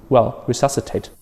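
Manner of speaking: normal talking voice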